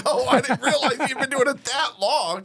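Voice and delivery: high, laughing surprise; surprised realization. Retold or imitated. Host reacting to Jim's twenty-seven years, laughing surprise. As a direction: act amazed while laughing. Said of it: "And I'm like".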